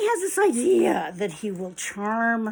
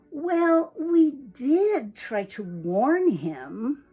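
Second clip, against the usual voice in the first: slow, higher, stress on did; told-you-so; 4 semitones higher, 4 dB louder, much slower than usual. Acted. Chicks after warning the fox, slow told-you-so. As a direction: draw it out as a knowing reminder. Chicks' smug last remark.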